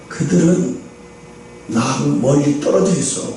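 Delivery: low, soft fatherly God speaking; God's low gentle reply. Acted. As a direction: answer tenderly as God to a child.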